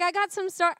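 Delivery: badly faked impression of her husband; Benji. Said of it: "I can't really do his voice".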